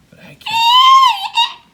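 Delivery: high, loud, slow wail; crying out. Acted. Wendy lamenting her lost broomstick, loud wail. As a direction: let it break into a sob. Near-sob shout in story.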